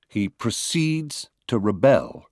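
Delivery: "proceeds" and "rebel" slowly, pushed on second syllables; verb stress. Shown as a demonstration. Lecturer showing stress by meaning, verbs "proceeds" and "rebel" stressed at the end. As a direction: say pro-CEEDS and re-BEL. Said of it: "stress will change according to the meaning".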